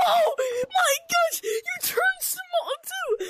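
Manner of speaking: high, drawn-out squeal of amazement; real high-pitched freak-out; excited screaming. Real, not acted. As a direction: scream it slowly, high and thrilled. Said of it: "I'm gonna stop screaming".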